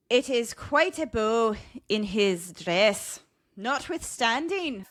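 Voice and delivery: slow, Scottish-sounding stab at Irish; attempted Irish accent. Host reading an old leprechaun description, attempted Irish accent. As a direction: read it slowly in a shaky Irish-Scottish accent. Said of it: "we're doing a Scottish accent".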